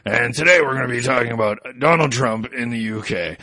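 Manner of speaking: a hint of a hammy American show host; Billy the Beaver. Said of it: "I'll give you the Billy the Beaver voice".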